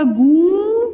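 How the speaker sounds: गुड़िया broken into long-held syllables; गुड़िया with each vowel overstretched; wrong long vowels. Shown as a demonstration. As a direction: drag out गुड़िया as "gooo... reee... yaa". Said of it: "मान लीजिए मैं अशुद्ध लिख".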